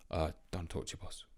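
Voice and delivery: low, soft mumbled footballer brush-off; a lightly done grumpy star player shutting down the boss; half-hearted mumbling player voice; Harry Kane impression. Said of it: "I don't know what his accent is".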